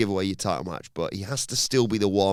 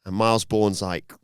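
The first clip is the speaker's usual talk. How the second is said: ordinary talk, nothing marked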